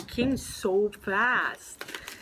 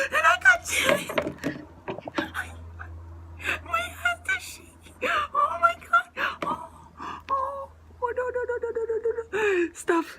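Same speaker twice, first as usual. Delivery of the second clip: high, loud tearful squeals; overwhelmed, crying with joy; real breathless happy crying; joyful sobbing; 13 semitones higher, 4 dB louder than usual. Real, not acted. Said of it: "I can't breathe".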